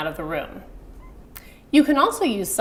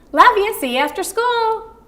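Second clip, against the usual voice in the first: breezy, much higher and louder; casual goodbye; 12 semitones higher, 5 dB louder than usual. Shown as a demonstration. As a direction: toss it off cheerfully at the school gate. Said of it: "more casual".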